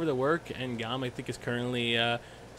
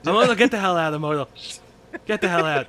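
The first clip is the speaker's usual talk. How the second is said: loud, raised-pitch order to leave; shouted dismissal; 3 semitones higher, 7 dB louder than usual. Real, not acted. Co-host ordering Immortal off the call, loud dismissal. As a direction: throw them out at full voice.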